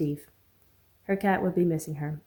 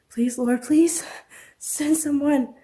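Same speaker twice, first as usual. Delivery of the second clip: high, loud crying out to God; desperate plea; 9 semitones higher, 5 dB louder, much slower than usual. Acted. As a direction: beg for rescue, rising in pitch. Trapped woman pleading in prayer.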